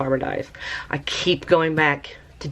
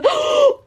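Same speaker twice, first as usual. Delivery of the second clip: sharp high gasp of delight; excited gasp; 4 dB louder than usual. Real, not acted. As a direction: gasp at the reveal. Dye reveals unexpected green.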